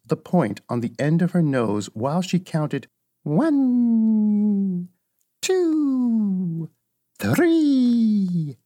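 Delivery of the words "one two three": high, long drawn-out solemn count. A narrator's reading. Old witch counting to work her magic, solemn drawn-out intoning. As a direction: intone each number like a spell. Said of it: "in a solemn voice".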